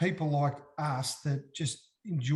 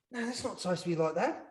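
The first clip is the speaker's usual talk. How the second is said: mock shock; 5 semitones higher, 3 dB quieter than usual. Reenacting the dye going wrong.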